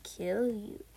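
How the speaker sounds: low, soft and deadpan; deadpan correction. Acted. Correcting gushing fangirls, low and deadpan. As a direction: drop low and quiet to burst their bubble.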